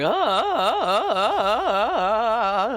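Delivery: high, loud cracking ah sounds, voice breaking on each one. Shown as a demonstration. Vampire VTuber showing viewers his flu voice, cracking and breaking. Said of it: "my voice is just cracking all over the place".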